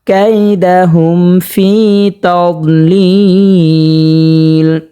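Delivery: slow, drawn-out melodic chant; Quran recitation. Shown as a demonstration. Teacher reciting the verse for viewers, slow melodic chant. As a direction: stretch each vowel in a sung recitation.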